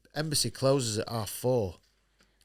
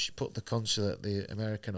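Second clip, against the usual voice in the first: ordinary talk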